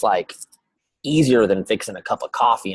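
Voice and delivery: ordinary talk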